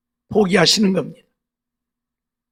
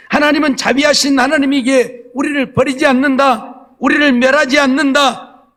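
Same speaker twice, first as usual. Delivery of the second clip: raised, emphatic pulpit voice; scripture proclamation; 5 semitones higher, 5 dB louder than usual. Real, not acted. Preacher declaring God's merciful covenant, emphatic proclamation. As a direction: proclaim each promise firmly to the congregation.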